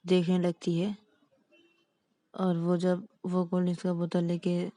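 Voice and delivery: usual speaking voice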